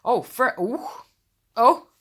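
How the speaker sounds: ordinary talk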